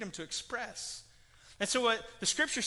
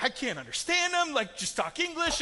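exasperated viewer; 8 semitones higher, 6 dB louder than usual. Retold or imitated. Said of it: "and you're like".

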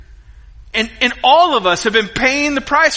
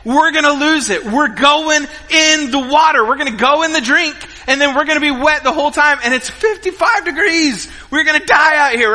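higher, mounting mock panic; hammed-up panicked inner voice, pitched up; retold terror; 6 semitones higher, 3 dB louder than usual. Dad fearing the kayak will tip, high mock-panicked rush. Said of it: "it was terrifying".

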